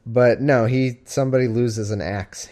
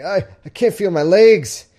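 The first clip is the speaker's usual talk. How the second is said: mock panicked cry, higher and louder; panicked injured man; 8 semitones higher, 6 dB louder than usual. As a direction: ham up a crushed man's panic. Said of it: "he's like".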